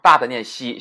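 ordinary delivery

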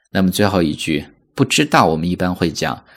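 ordinary talk, nothing marked

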